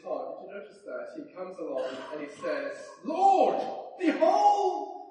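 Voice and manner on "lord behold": high, loud joyful cry. Acted. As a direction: exclaim it overjoyed, drawing out the words. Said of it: "Can you hear the joy in his voice?".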